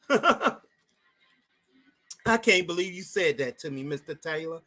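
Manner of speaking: lightly imitated Southern accent; a touch of Southern sweetness. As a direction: play a sweet Southern woman, softly. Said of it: "very southern, very southern".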